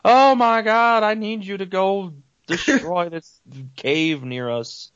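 high, drawn-out wail to a god; pleading follower. Acted. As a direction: mock-desperate villager begging loudly for help. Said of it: "and they're like".

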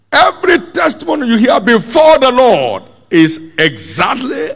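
high-pitched, fervent preacher delivery; full-force preaching. Real, not acted.

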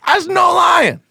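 high, loud protest; indignant shout. Real, not acted. Friend insisting he is not lying, shouting. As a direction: yell it back in protest.

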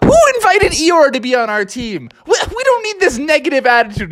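Froggy high-voiced telling his team off; excited Froggy. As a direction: pitch way up as Froggy. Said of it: "And Froggy's like".

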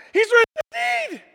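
high, full-voiced yell of reply; loud high-pitched burst of recognition; exuberant shout. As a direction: shout back the answer excitedly. Imitates Dutch person answering back.

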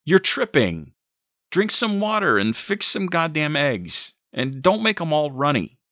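high, loud, irritated orders; bossy shouting. A narrator's reading. Boyfriend demands breakfast from mother, loud irritated orders. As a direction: boss them about from another room.

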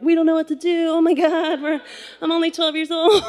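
high, whiny mock panic; panicked kid. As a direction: wail like an overwhelmed child. Panicked kids answering dad's orders.

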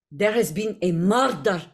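Slavic accent, each word separate; measured Slavic delivery, hard R in "murder"; Jaheira's Slavic accent. Shown as a demonstration. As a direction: say it in a Slavic game-character accent. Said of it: "say it in a Jaheira".

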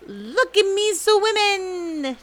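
very high, long, loud squeal; drawn-out childlike boast; bubbling proudly. A narrator's reading. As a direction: squeal it proudly like a little joey. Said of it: "still bubbling proudly".

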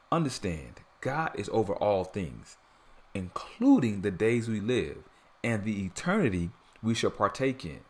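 ordinary talk, nothing marked

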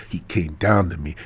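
normal talking voice

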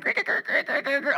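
high voiced creaking sounds; imitated bed creaks. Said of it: "And I'm hearing".

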